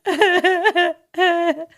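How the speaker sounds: very high, hammed-up sobbing and laughing; fake-weeping starlet filming herself, wailing; mock crying celebrity. Mocking a celebrity crying on Instagram, high fake sobs. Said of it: "Think about the steps there".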